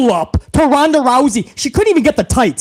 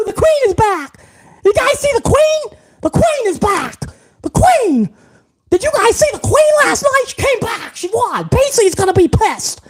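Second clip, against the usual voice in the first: much higher, squealing fan gloating; mocking gleeful fan; 9 semitones higher than usual. Mocking fans taunting B C about the queen's return. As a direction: ham up a giddy fan crowing over B C.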